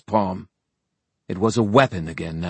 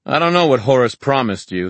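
raised, loud smug taunt; self-satisfied challenge; cool satisfaction; 7 semitones higher, 8 dB louder than usual. A narrator's reading. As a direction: needle him with cool confidence. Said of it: "cool satisfaction in his tone".

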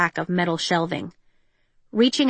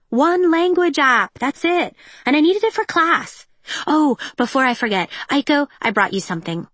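put-on high, quick-talking teen girl; bright young stepsister voice; bubbly teen girl; 7 semitones higher, 4 dB louder than usual.

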